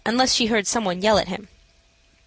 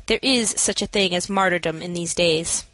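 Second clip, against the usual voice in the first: plain reading voice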